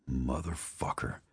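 soft, slow bitter curse; muttered. A narrator's reading. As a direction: mutter it under breath. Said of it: "Spencer muttered".

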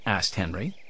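usual speaking voice

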